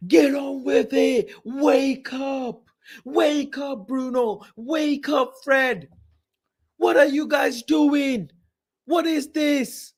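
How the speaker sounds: high, slow jeering yells at the players; angry fan heckling. Retold or imitated. Fan in the Old Trafford stands yelling at players, angry. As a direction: bellow at the pitch like a fed-up fan. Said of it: "That's what I'm hearing in Old Trafford".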